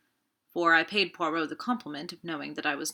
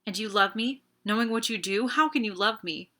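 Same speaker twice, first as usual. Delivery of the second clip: sharp, accusing and a bit louder; a savage challenge to her lover; almost savage; 4 semitones higher, 4 dB louder than usual. A narrator's reading. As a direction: turn on him with bitter force. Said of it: "she turned on me almost savagely".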